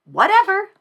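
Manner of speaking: whatever, very high and loud; squealed whatever. Shown as a demonstration. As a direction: fling the word up to a squeal. Climax of mock rant.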